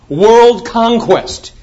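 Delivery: "World conquest" stretched very slowly, loud and high; awed proclamation of "World conquest", drawn out; slow emphatic. Acted. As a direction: lay down each word heavily. Preacher marvels at the command.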